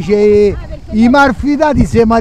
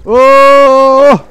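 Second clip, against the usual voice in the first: raised voice across the water; calling out; 6 semitones higher, 4 dB louder, slower than usual. Real, not acted. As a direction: call to someone far away. Calling to woman from distance.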